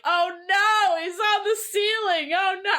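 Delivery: put-on high, squeaky Brooklyn accent. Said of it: "like, Brooklyn accent".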